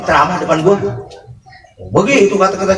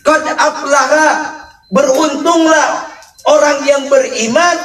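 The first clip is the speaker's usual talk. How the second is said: preaching at full force; 10 semitones higher, slower than usual. Real, not acted. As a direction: proclaim each word to the crowd.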